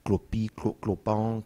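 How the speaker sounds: "clopi" slowed and drawn out, then "clopant" broken after "clo-"; playing with sounds. Shown as a demonstration. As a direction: let "clopi" linger slowly, cut "clo-" short, then land "clopant". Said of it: "il entend tout de suite clope".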